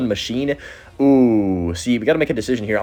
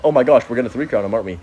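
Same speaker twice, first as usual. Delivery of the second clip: usual speaking voice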